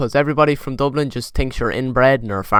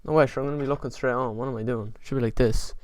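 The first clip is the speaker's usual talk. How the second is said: ordinary delivery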